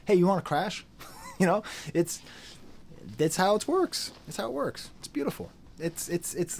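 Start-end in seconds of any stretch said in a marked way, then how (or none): 0.0-0.8 casual invite. Retold or imitated.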